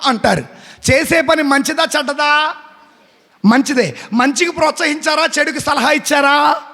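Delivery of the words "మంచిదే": ordinary talk, nothing marked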